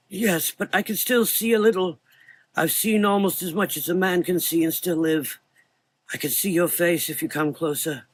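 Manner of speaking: put-on high old voice; frail old man. A narrator's reading. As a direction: speak as the half-blind old doctor. Old doctor's voice.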